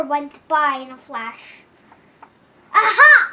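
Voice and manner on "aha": loud, high cry of triumph; triumphant aha. Acted. Kid finishing the poem, loud triumphant cry. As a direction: shout it as you finish the last line. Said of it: "I said when I was finished".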